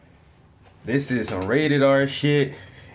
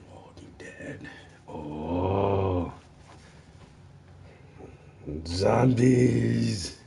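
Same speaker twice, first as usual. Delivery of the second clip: drawn-out, spooky zombie moan; hammed-up horror voice; mock zombie voice; 3 semitones lower, 4 dB quieter, much slower than usual. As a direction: play-act a slow, ghoulish groan. Spookily mocks a zombie show title.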